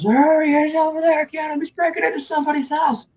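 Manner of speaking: high, urgent calling out; excited calling out. Retold or imitated. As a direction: point him out, high and eager. Calling out to the others.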